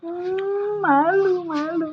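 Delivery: high, mock-bashful child voice; shy student. Teacher imitating pupils too shy, high and bashful. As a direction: squeak it like a timid kid.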